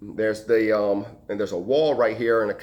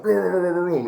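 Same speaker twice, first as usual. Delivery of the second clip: higher, louder rolling rumble noise; rumbling door imitation; 7 semitones higher, 4 dB louder than usual. Firefighter imitating the door behind the woman, rumbling noise. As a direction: imitate a door rattling in the background. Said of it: "hear this door kinda like".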